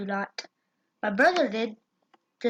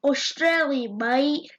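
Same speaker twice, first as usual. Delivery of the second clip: loud, higher mock Australian accent; 4 semitones higher, 5 dB louder than usual. Shown as a demonstration. Neighbor asked for his best accent, loud Australian accent. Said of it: "say your best accent".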